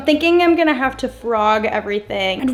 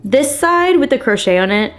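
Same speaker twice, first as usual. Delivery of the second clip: ordinary talk, nothing marked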